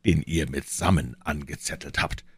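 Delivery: plain reading voice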